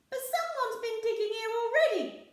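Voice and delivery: a worried little pirate; Ben Buckle. A narrator's reading. Ben Buckle warning crewmates, high and loud. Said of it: "said Ben Buckle".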